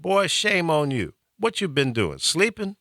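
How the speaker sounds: a hint of a mother chiding her boy; Southern mother scolding. Host imitating his late Southern mother scolding him. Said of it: "she would have said".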